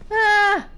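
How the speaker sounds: short, high loud cry; startled yelp. Real, not acted. Player caught off guard in the game, loud high yelp.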